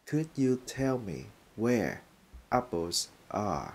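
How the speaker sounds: slow, careful English model sentence; slow clear demo. Shown as a demonstration. Teacher modelling a polite question slowly. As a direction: say it slowly for learners. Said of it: "Mình sẽ nói lại câu này".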